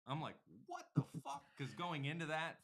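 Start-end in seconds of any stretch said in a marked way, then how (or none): 0.7-1.4 high-pitched incredulous outburst; exaggerated baffled reaction; retold disbelief. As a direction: act out being floored by the answer.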